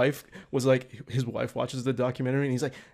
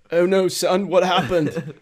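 louder mock-Australian parent; bad Australian accent; 4 semitones higher, 6 dB louder, slower than usual. Parent asking son about divorce, bad Australian accent. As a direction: fake an Australian accent, badly, as a concerned parent. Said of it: "bad Australian accent that turned into not".

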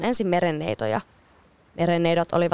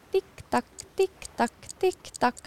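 clock ticking; 6 semitones higher, 4 dB quieter, slower than usual. Acted.

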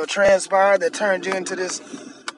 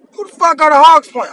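louder, higher, baffled; frustrated louder question; exasperated outburst; 7 semitones higher, 7 dB louder than usual. Real, not acted. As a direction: blurt it out in frustration. Confused about playoff matchups.

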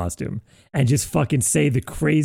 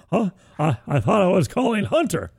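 lightly voiced confused old president, higher; a hint of Biden, higher and laughing; Biden impression; 6 semitones higher than usual. Host lightly mimics Biden after nuking California by mistake.

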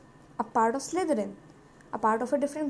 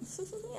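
very soft high titter; short, high little giggle; 10 semitones higher, 11 dB quieter than usual. Real, not acted. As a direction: titter under your words. Laughs between happy and sad.

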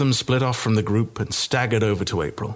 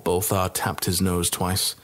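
plain reading voice

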